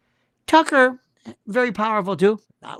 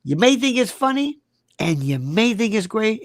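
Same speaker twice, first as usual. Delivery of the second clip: ordinary delivery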